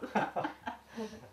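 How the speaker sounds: high, breath-filled laughter; real laugh. Real, not acted. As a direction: keep laughing after the words. 말끝에 이어진 웃음. (숨이 섞인 높은 웃음)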